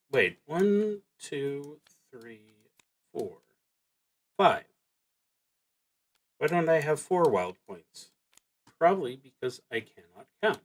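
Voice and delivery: ordinary talk, nothing marked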